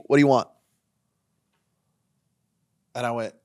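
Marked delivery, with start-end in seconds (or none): 0.0-0.5 loud curt demand. Retold or imitated. Imitated bartender, sharp and loud. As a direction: snap it out loud without looking up. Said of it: "Just cleaning".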